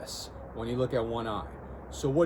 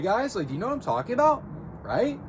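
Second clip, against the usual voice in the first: "about" and "Right" swooping up high; exaggerated upward inflection; 9 semitones higher than usual. Shown as a demonstration. Coach showing how weird it sounds, "Right" with exaggerated upward inflection. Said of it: "it would be weird if I did that".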